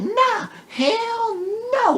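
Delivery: very high, slow "hell no"; drawn-out high refusal. Retold or imitated. Wife refuses again, slow high sassy voice. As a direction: stretch it high like an outraged woman.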